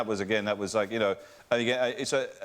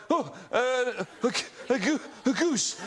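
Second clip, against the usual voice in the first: high, stammering, panicked; flustered stammer; 9 semitones higher, slower than usual. Retold or imitated. Answering the armed officer, a high panicked stammer. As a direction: stumble over goose at gunpoint. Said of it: "and I went".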